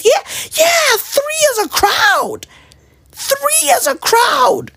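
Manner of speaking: very high, drawn-out shouting; comic high-pitched holler, words stretched out; mock outraged retort. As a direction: squeal it slowly in mock outrage.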